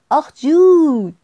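loud, high, stretched hooray; joyful cheer. A narrator's reading. Fox cheers going out to play, loud high whoop.

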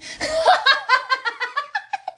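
high-pitched helpless cackle; high giggling fit; real laugh. Real, not acted. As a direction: burst out laughing at your own joke. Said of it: "It still makes me laugh".

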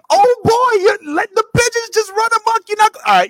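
very high, loud scolding; loud outrage. Real, not acted. Co-host scolds player over runaway pigeons, loud outrage. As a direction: carry on at the top of your voice.

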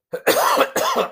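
harsh throat clearing; chesty cough. Real, not acted. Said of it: "Sorry for the coughing".

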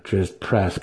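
ordinary delivery

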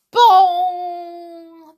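loud, high ringing bong; clock bell chime. A narrator's reading. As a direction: imitate Big Ben, letting the note ring out. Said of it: "Ben began to chime".

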